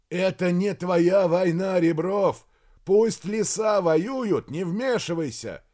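loud, high, slow and emphatic; shouted warning. A narrator's reading. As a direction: warn him off at a shout. Colonel shouts argument. (громко, с нажимом)

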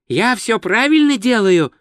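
high, anxious question; worried. Acted. Tourist holding a sword asks wizard, worried and high. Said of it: "озабоченно спросил Два Цветок".

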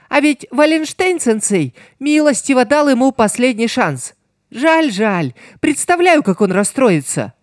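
high, playful gloating villain. A narrator's reading. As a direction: play him high and gloating. Voices Piero.

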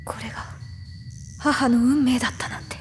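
whispered in grief; grieving whisper. Acted. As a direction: barely breathe the words. Narrator grieving mother's fate. (悲しみのささやき)

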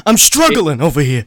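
put-on thick New York accent; old New York politician's accent, hammed up; Bernie Sanders. Mocking an old candidate from New York, thick New York accent. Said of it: "a thick New York accent like that".

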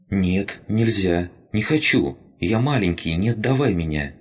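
a tiny creature pleading to stay; pleading rabbit spirit. Acted.

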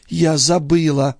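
high, slow woman's voice; entranced sister. Acted. Sister answers brother's questioning, high and slow.

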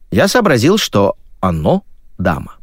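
ordinary delivery

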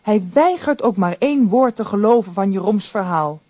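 ordinary talk, nothing marked

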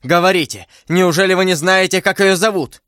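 high, pressing question; urgent outcry. A narrator's reading. D'Artagnan pressing his beloved for a name, urgent. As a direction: press her anxiously for an answer. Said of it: "вскричал Д'Артаньян".

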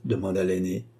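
ordinary delivery

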